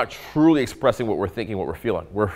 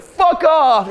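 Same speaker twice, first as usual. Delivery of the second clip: high, loud snapped brush-off; mock angry rejection; 6 dB louder than usual. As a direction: fire it back like a woman rejecting him. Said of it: "And she's like".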